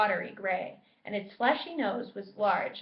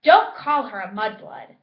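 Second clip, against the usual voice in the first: loud, sharp rebuke; very angry; 11 dB louder than usual. A narrator's reading. Ron and Ginny rebuking the elf, loud. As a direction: snap at him hard. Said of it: "very angrily".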